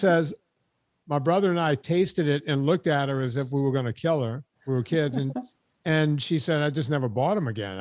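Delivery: ordinary talk, nothing marked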